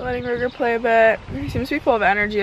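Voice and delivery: ordinary talk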